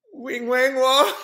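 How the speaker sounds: high, rising sing-song up to WONG; catchphrase blurted out. Retold or imitated. Zoned-out customer answers the woman at the café, blurting a sing-song catchphrase. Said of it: "the second I do it".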